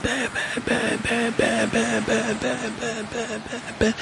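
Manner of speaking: high, quick bam bam sounds, half laughing; imitated hammer bangs. Telling how the robber smashed the car, bam bam. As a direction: bang it out like a hammer.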